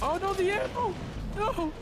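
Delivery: very high squealed lament; high-pitched cry of loss; dismayed squeal. Real, not acted. Loses the ammo in game.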